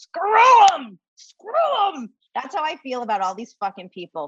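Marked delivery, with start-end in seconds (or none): 0.0-2.1 high anguished cry imitating the film line; a loud, pitched-up movie-quote wail; Colonel Ludlow roar. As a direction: cry it out like a broken old colonel. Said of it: "Anthony Hopkins, Colonel Ludlow, goes".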